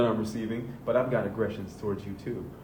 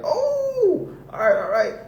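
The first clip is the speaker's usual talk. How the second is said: very high, loud squeal of relief; hammed-up relieved yelp; judgment giving in; 11 dB louder, slower than usual. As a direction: squeal that it finally feels better. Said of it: "they're like".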